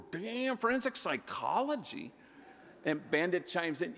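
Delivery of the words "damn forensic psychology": high, amazed disbelief; surprised. Retold or imitated. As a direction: gasp it out in surprise. Stunned reaction to daughter's answer.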